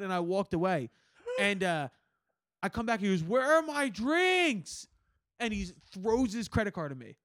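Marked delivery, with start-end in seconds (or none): 3.2-4.9 high, slow mock drunk holler; whooping, drawn-out demand. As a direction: drag out the words like a drunk. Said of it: "He's fucking shit-faced".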